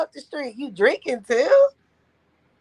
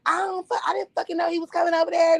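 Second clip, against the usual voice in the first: mother swearing; 6 semitones higher, faster than usual. Retold or imitated. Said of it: "that's how she was talking".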